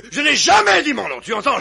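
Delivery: laughing, insistent denial. Acted. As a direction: hammer the denial home. Furax insisting, half laughing.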